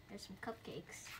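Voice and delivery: unvoiced whispered remark. Real, not acted. Reader pointing out cupcakes, whispered. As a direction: whisper it to the viewer.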